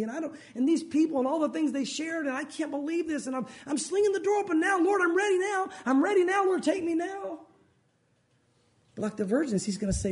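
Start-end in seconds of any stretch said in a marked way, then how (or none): 0.0-7.5 high-pitched, urgent acted plea; desperate pleading. One left behind slings door open to the Lord, desperate pleading. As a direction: beg to be taken, voice climbing higher.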